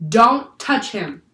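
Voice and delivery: slow, loud, drawn-out shout. A narrator's reading. Jack shouts at sailors to spare the octopus, slowly and loudly. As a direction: plead loudly, word by word. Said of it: "he shouted".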